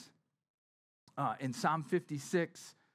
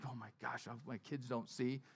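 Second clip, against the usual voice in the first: choked-up aside; 4 semitones lower, 9 dB quieter than usual. Retold or imitated. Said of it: "I'm like".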